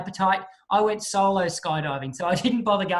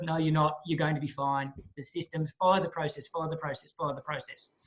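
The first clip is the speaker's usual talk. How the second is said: softer, lower reassurance fading away; calm the nervous jumper down gently; soothing coach; 5 semitones lower, 5 dB quieter than usual. Retold or imitated. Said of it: "coaching you to get out of the mindset".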